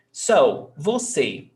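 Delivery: usual speaking voice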